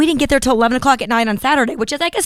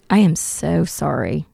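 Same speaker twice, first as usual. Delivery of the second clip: soft mock-sincere, quieter and lower; fake sincere apology; 5 semitones lower, 5 dB quieter than usual. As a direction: apologise sweetly without meaning it. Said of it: "so close to sounding sincere".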